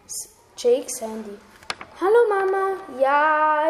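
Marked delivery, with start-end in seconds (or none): 2.0-3.7 excited. Acted. Jake answers phone excitedly.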